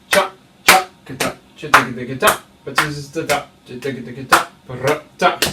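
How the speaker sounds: spoken drum pattern; vocalised drum rhythm. Shown as a demonstration. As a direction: imitate snare strokes in syllables. Drum teacher voices the rhythm.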